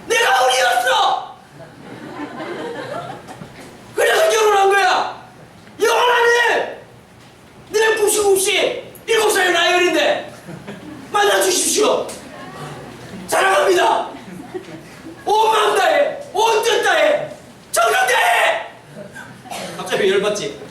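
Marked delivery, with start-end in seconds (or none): 0.1-6.8 high, slow, shouted admission; preacher shouting a joke to students; mock confession. Real, not acted. Confessing loudly about his marriage. 7.7-18.7 high, pleading, laughing suitor; preacher acting his proposal to his wife; mock proposal.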